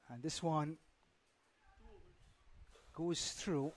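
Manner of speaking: normal talking voice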